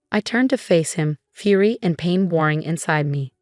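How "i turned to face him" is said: ordinary delivery, nothing marked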